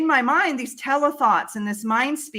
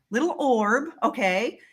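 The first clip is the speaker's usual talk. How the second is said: ordinary delivery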